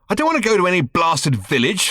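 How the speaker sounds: sharp irritated snap; snapped. Acted. Ford snaps at Arthur about the village, irritated snap. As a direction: bite the words off in annoyance. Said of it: "snapped Ford".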